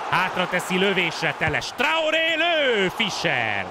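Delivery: high, slow stretched call; excited Hungarian sports commentary. Acted. Shot called out.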